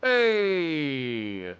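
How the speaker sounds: ordinary talk, nothing marked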